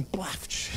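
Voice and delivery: soft, airy "ploft"; hushed puff of cloth falling open; imitated flop sound. Client showing how folded underpants open, imitated soft flop. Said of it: "ela faz assim".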